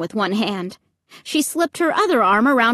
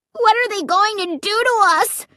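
much higher, panicked wail; terrified cry; 12 semitones higher, 3 dB louder than usual. A narrator's reading. Little brother wails at approaching horrors, panicked. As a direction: cry out in real terror. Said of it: "he cried".

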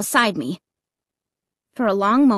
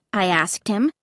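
plain reading voice

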